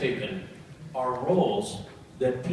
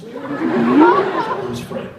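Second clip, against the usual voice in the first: drawn-out laughing fondness for the horse Fred; laughing fondly; 10 semitones higher, 8 dB louder, much slower than usual. Real, not acted. Telling fans about his old horse Fred, slow laughing fondness. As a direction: stretch it out, laughing at the horse's name.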